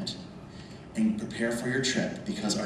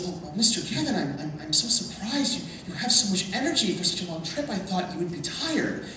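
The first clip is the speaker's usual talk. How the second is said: quoting the translator, a little higher; light, higher-pitched impression of a translator; lightly voiced translator; 6 semitones higher than usual. Translator surprised at the traveler's energy, lightly voiced. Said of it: "and she goes".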